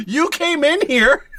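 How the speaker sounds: squeaky voice, slow and pointed; high slow shopkeeper. Acted. Clerk blames customer, slow high.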